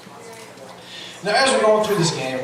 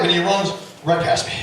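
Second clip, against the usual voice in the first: ordinary talk, nothing marked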